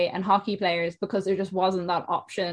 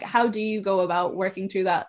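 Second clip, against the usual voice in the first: ordinary delivery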